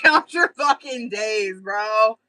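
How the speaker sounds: punchy mock menace. Real, not acted. Repeats threat at the post office.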